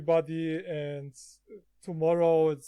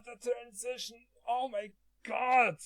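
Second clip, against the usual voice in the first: higher-pitched, rattled exclamation; startled; 6 semitones higher than usual. Real, not acted. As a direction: react to a sudden deafening blast. Startled by blaring transition sound.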